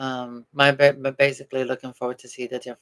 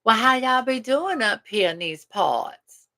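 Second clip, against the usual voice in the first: higher, slow mock Southern country accent; playful fake Southern country twang; 9 semitones higher, 4 dB louder, slower than usual. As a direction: ham up a folksy Southern drawl. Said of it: "you start talking all country".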